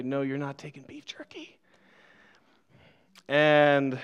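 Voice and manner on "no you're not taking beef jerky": mock plea, whispered; soft whispered no, you're not taking it; whispered protest. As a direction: whisper it, half to yourself. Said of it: "run after and say".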